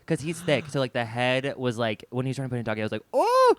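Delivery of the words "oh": high, loud "Oh!"; retold yelp. Retelling when he tried doggy, loud mock yelp. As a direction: mimic a startled, high yelp. Retold cry as partner enters.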